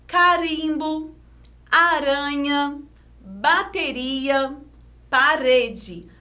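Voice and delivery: "Carimbo" and "aranha" as whole words, slowly; read without syllabifying. Shown as a demonstration. Teacher rereading examples whole, no syllable breaks. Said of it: "vamos ler sem silabar".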